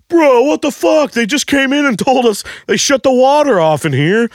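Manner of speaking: very high, frantic ranting; outraged scolding. Retold or imitated. Coworkers to the man who used the toilet, high outraged rant. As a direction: rant in disbelief, voice stuck way up high.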